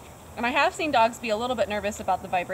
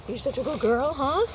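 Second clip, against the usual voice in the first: dog praise voice; 7 semitones higher, slower than usual. Real, not acted. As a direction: coo praise up high to a shy dog. Trainer praising shy dog Lucy.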